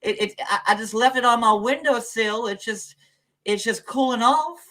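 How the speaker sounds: high, loud hammed-up Southern accent. Offering pie off the windowsill, high Southern accent. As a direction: put on an exaggerated high Southern voice. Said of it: "that's not Southern".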